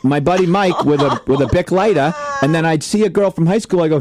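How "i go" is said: ordinary delivery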